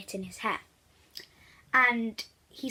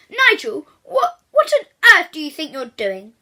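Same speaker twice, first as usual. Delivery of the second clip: loud, higher outraged teacher; angry demand; 5 semitones higher, 12 dB louder than usual. Acted. Teacher Mister Drumham yelling at Nigel, outraged. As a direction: bellow at the boy in outrage. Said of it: "demanded Mister Drumham".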